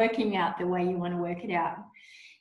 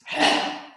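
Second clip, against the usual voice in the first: noisy breath out. Shown as a demonstration. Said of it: "try the tantrum breath".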